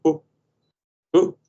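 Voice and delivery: high, loud straining ooh; mock excited ooh. Playing X-Wing on a joystick, high loud oohs. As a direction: strain like you're gripping the joystick. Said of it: "You're like".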